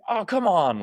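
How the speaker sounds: mock a fan's frustrated groan of protest. Fan venting at a struggling player, frustrated. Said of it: "you could be frustrated".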